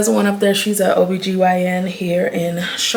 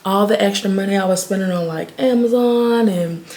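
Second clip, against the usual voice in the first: ordinary talk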